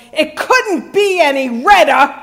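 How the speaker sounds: a crossing guard's sarcastic jab; sarcastic parting shot. Retold or imitated. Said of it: "her parting shot".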